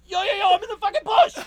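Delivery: high, loud panicked yell; retold yell. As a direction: shout it like he's being shoved into a bush.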